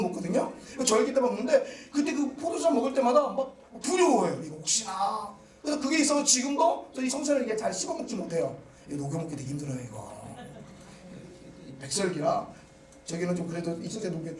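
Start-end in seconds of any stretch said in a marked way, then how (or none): none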